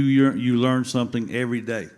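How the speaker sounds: normal talking voice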